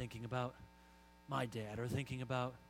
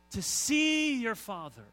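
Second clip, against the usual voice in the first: slow, high and loud on see; proclaiming; 8 semitones higher, 12 dB louder, slower than usual. Real, not acted. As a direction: call out to see your Father. Preacher proclaims climax.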